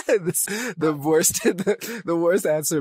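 words breaking up into giggles; real laughing. Real, not acted. Speaker admitting a poor answer to interviewer, laughing.

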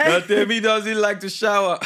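high, loud laughing announcement; laughing loudly. Real, not acted. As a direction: burst out laughing while telling.

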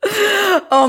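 real high-pitched squeal of laughter; helpless laughter. Real, not acted. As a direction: plead through uncontrollable giggles. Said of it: "I can't stop laughing".

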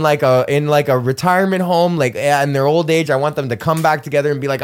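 normal talking voice

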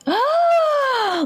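high shocked gasp; big gasp. Real, not acted. Realises the princess twist.

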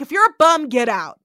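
gruff order. Retold or imitated. Edith ordering bums out.

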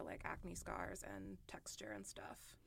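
slow, breathy trailing whisper. Real, not acted. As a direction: let it fade to a whisper. Trailing listing of skin problems.

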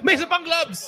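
high, loud shout with laughter; excited announcement. Real, not acted. Host tells players another prize remains, excited shout. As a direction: call it out loudly, laughing.

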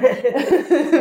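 high burst of laughter; real laugh. Real, not acted. Partner caught having doubts, laughing. Partner reacts to being found out.

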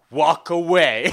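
imitating a stern, forceful dismissal; retold super serious. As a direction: draw it out as a loud mock threat. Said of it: "super serious".

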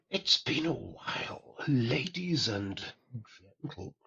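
breathy ASMR whisper into the mic. Pretend. Caller greets the podcast in a mock ASMR whisper. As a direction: whisper it close like an ASMR video. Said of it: "in the donkey A S M R".

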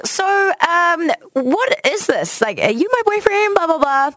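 high, drawn-out, tentative voice; mock your own nervous fishing for an answer; awkward questioning. Asking a casual date about being official, awkwardly high-voiced.

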